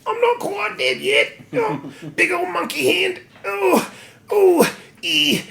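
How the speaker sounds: very high, squeaky monkey-man voice. Retold or imitated. Monkey Man waking up, in a squeaky put-on monkey voice. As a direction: squeal it like a cartoon monkey, ending in hoots.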